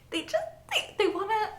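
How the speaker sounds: very high, halting squeak; too excited to finish the sentence; choked-up happy stammer, pitched right up; overwhelmed. Real, not acted. Overcome fan cannot finish sentence.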